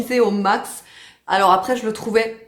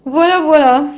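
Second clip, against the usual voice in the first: pet talk; 6 semitones higher, 10 dB louder than usual. Real, not acted. Cooing to the pet.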